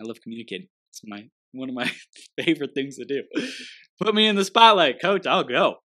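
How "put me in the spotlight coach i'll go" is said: high, loud and eager; eager shout. Real, not acted. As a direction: call out like a keen player. Eager to take the spotlight.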